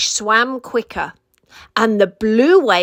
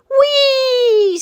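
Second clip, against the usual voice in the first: joyful whee; 14 semitones higher, 5 dB louder than usual. Acted. Diving joyfully into water.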